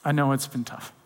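low, soft, consoling voice; close, tender empathy. Acted. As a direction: acknowledge their pain softly, up close. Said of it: "He comes close and he says".

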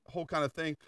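ordinary talk

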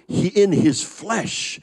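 ordinary talk, nothing marked

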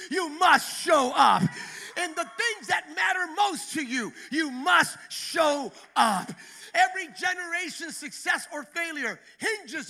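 loud, slow, building refrain hammering show up; full-voiced revival preaching; preaching at full force. Real, not acted. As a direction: preach it, every line harder. Rising refrain of sermon climax.

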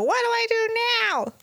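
put-on very high Jersey-accented Muppet voice; a helpless Rapunzel in a high Jersey accent. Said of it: "she'd go".

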